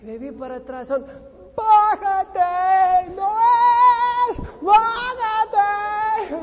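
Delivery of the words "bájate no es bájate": very high, loud, slow drawn-out shout; shouted warning from the platform; yelled command. Retold or imitated. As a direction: bellow it slowly across the distance. Distant voice yelling get off.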